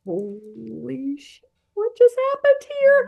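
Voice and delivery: re-enacted astonished outburst; acted stunned realization. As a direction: draw out the first words, then yelp. Voices both men's simultaneous stunned realization.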